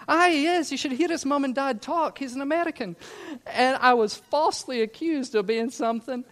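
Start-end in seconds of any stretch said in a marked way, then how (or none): 0.1-3.0 friends defending. Retold or imitated. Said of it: "my friends were like".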